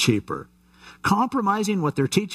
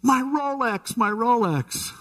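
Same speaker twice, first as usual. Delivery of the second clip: very high, whining moan for the watch; mock-wail the punchline far up in pitch; wailing injured yuppie; 10 semitones higher, slower than usual. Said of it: "And he goes".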